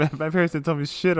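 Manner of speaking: usual speaking voice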